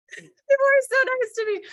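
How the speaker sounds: high, loud laughing gush. Real, not acted. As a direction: say it through laughter, pitched right up. Laughing after her own anecdote.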